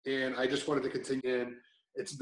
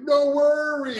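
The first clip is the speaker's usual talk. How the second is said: high, loud mock accent; Simon's accent; 12 semitones higher, 9 dB louder, slower than usual. Coach to someone who fell, cheery put-on accent. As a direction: call it out in a hammed-up coach's accent. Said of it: "who will tell you".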